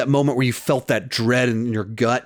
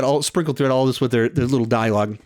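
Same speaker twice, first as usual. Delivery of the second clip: normal talking voice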